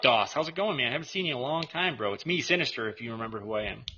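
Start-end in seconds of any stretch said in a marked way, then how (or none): none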